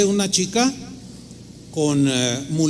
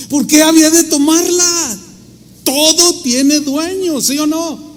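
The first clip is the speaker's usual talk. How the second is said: high, insistent rhetorical questioning; emphatic preaching; 9 semitones higher, 5 dB louder, slower than usual. Real, not acted. Preacher pressing listeners on honesty, emphatic. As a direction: drive the moral home, pitched up and drawn out.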